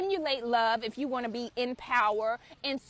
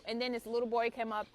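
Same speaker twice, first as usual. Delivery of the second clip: ordinary talk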